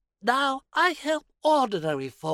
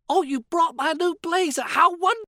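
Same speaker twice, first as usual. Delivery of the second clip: excited; 6 semitones higher, 5 dB louder than usual. Acted. Delighted at new blazer.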